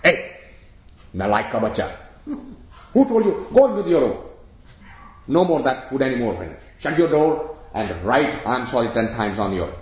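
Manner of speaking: scolding mother. Retold or imitated. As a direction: tell off the naughty child sharply. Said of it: "What do you think the mother did?".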